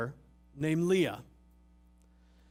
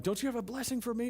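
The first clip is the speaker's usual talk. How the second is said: fast, higher desperate plea; pleading brother; 7 semitones higher, faster than usual. Retold or imitated. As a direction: blurt the plea in a panic. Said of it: "And he's freaking out".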